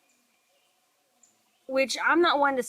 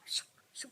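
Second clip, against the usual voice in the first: whispered shoo sound; 16 dB quieter than usual. Retold or imitated. Imitating the ghostly sound.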